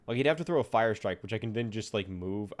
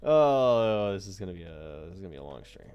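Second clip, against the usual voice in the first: weary dread; 4 semitones lower, 6 dB louder than usual. Real, not acted. Groans at the task ahead.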